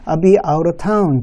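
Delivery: slow "I'll be out of town", L dropped, "out of" as "ou-ruh"; model pronunciation. Shown as a demonstration. Teacher has students repeat, slow linked "I'll be out of town". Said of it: "또 한번".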